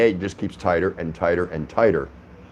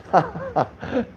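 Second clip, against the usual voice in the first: high laugh; 14 semitones higher, 3 dB quieter than usual. Real, not acted. Amused at phone trick.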